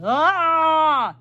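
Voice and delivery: long loud high holler; big storybook yelp, held long; father's yell. A narrator's reading. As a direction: bellow out a drawn-out "ah" of pain. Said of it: "and he yelled".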